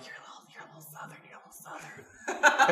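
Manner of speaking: laughing whispered tease; retold whisper. Teasing James over the phone, laughing whisper. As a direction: whisper it through giggles, teasing. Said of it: "And I call James and I'm like".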